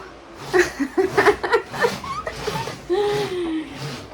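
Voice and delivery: high, tearful fit of laughter; long helpless laugh. Real, not acted. Mother laughing at her son playing in the baby walker, high helpless laugh. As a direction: laugh until it nearly turns to crying.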